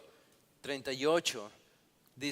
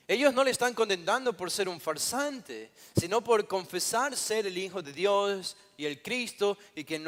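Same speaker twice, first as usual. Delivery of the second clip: loud, higher-pitched pulpit argument; emphatic preaching; 5 semitones higher, 6 dB louder than usual. Real, not acted. Preacher exposing the Sanedrín's irony to the congregation, emphatic raised preaching. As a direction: drive each point home with raised voice.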